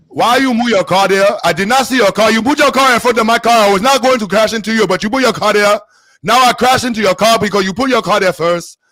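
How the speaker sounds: put-on high, loud Nigerian-accented scolding; mock Nigerian accent. Driver blaming another for a crash, loud mock Nigerian accent. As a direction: argue in a hammed-up Nigerian accent. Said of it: "Then the Nigerian".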